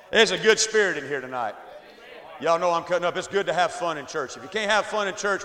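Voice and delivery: ordinary talk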